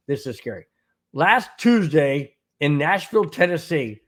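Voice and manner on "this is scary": "This is scary" dropped softer and lower; softer repeat. Real, not acted. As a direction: hush the repeat for effect.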